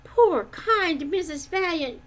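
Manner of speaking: lightly voiced fretful woman; groaning. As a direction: moan it in mild despair. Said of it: "groaned Missus Dismal".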